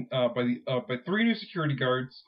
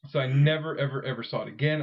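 usual speaking voice

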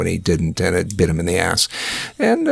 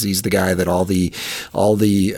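ordinary delivery

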